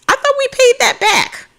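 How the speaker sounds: surprised squeak. Real, not acted. Player shocked the debt is unpaid, laughing high squeal.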